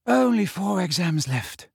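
a tired, drawn-out sigh of "Only"; weary. A narrator's reading. Parvati heading back after the exam, weary and slow. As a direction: let "Only four exams left" droop with tiredness. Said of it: "said Parvati Patil wearily".

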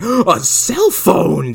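gasping, drawn-out mock wonder; high, loud, stretched-out awe; mock amazement. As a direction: marvel loudly and slowly, hammed up. Mocking movie characters' awe at gadget.

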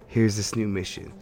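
normal talking voice

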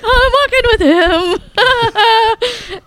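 high, slow, laughing squeal; crazy fangirl. Retold or imitated. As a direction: gush like a starstruck fan. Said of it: "Like this crazy chick".

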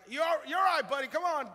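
high, loud dad pep-up; hearty urging. Retold or imitated. Dad to crying son after a fall, loud hearty urging. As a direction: shout it to brush off a child's tumble.

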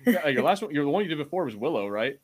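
ordinary talk, nothing marked

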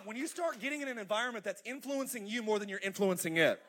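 normal talking voice

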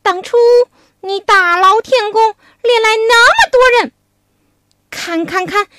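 hammed-up furious pig demon cursing; mock angry scolding. Pig demon curses Wukong while swinging his rake, mock angry. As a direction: spit out the insults like a brawler. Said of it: "大骂悟空".

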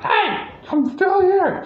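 high, drawn-out cartoonish holler; mock Penguin squawk. Friend imitating the Penguin waddling after being left, high mock holler. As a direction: call out in a high, strained imitation. Acting out stranded Penguin yelling.

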